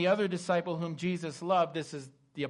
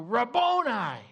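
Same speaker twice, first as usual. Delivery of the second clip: joyful recognition cry; 6 semitones higher, 5 dB louder, slower than usual. Acted. Mary recognizes risen Jesus.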